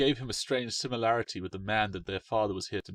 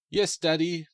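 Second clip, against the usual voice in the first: put-on children in unison; 8 semitones higher, 6 dB louder, slower than usual. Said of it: "they said in unison".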